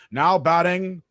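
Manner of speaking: hint of a ballpark PA announcer; stadium announcer. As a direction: call it out like a stadium PA man. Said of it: "He hears the announcer going".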